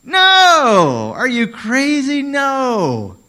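high, loud incredulous No!; Paul shouting No! at the question; shocked shouting no. Retold or imitated. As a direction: yell it like the idea is insane. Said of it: "the written version of him saying".

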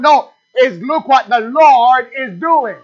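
slow, emphatic correction; stress on Lord. Shown as a demonstration. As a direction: answer the worry by hitting "Lord". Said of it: "look what the pandemic is doing".

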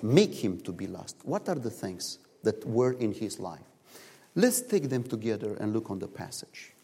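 ordinary talk

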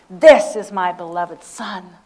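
a touch of a proud father; proud claim. Acted. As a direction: claim him with pride. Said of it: "Hear the pride".

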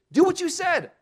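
high, loud, insistent call; bold demand. Retold or imitated. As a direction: demand it of God boldly. Bold prayer demanding God keep promises.

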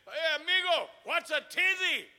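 puzzled question in a put-on Mexican accent. Mexican churchgoer asking the visiting preacher about a word, light Mexican accent. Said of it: "some Mexican brother back, he said".

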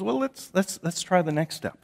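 lightly voiced patient teacher nudging them on; gentle Jesus encouraging. Jesus coaxing the disciples to the next step, gently. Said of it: "he goes".

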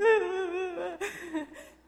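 high loud laughing through tears; teary laugh. Real, not acted. Retelling husband finding her crying, laughing tearfully. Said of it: "sees me".

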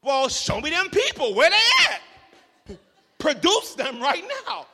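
emphatic preacher challenge. Real, not acted. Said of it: "challenging the critics".

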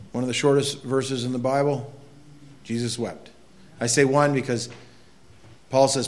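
normal talking voice

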